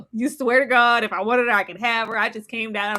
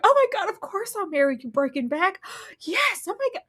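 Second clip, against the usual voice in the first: high, fast breathless gush; mock gushing acceptance; 9 semitones higher than usual. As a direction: swoon over the proposal. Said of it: "She's like".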